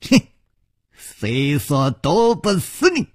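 slow, drawn-out villain's taunt; snort, then taunt slowly with menace; sinister sneer. Acted. Bai Yumo threatens Chu before striking, sinister sneer. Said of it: "白玉魔狞笑着说".

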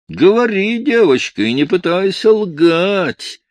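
loud threatening bully; menacing command. Acted. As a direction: bear down on her with threat. Stern man bullies frightened girl.

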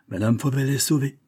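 ordinary delivery

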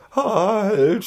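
high, loud cry of alarm; shouted cry. A narrator's reading. Schoko yelling as the carriage rolls off, shouted. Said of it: "schrie Schoko".

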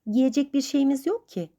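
a touch of pouty protest; sulky pout. A narrator's reading. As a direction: complain through a slight pout. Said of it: "Joe surat asarak".

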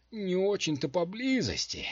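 high, hesitant hedging; doubtful admission. A narrator's reading. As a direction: admit it with doubt. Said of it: "с сомнением признался отшельник".

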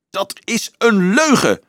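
high, loud furious outburst; furious roar. A narrator's reading. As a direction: hurl the denial back in rage. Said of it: "brulde Dolf woedend".

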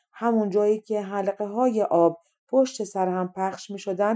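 plain reading voice

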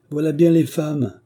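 loud, high reproach; scornful exclamation. A narrator's reading. Dufay scolds the women.

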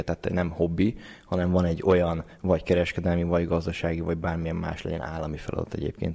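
ordinary talk, nothing marked

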